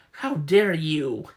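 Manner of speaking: slow, loud, outraged; how dare you, slow and loud; indignant. Acted. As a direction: drag out the offence. Offended girl confronts them.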